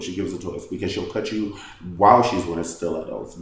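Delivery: usual speaking voice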